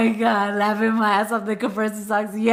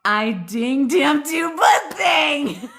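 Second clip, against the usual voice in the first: loud, drawn-out teasing call across the chat; playful shout; 6 semitones higher, 4 dB louder, much slower than usual. Real, not acted. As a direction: call him out, half shouting and laughing. Playfully calling out a friend.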